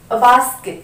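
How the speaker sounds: a basket said joined, əbasket with no break; linked as one word. Shown as a demonstration. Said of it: "बिल्कुल एक word जैसे pronounce करेंगे". ("a basket" एक शब्द की तरह जोड़कर)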